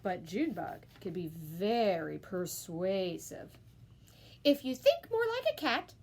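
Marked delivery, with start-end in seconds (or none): 4.4-5.9 high coaxing mother voice; a mother persuading her kitten son; coaxing mama. A narrator's reading. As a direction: sweet-talk him into it. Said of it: "she said".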